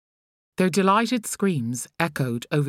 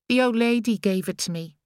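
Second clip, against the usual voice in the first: higher, lightly voiced little boy; child's voice; 7 semitones higher than usual. A narrator's reading. Boy telling his mother about the lollipop, childlike. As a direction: speak it like a small boy.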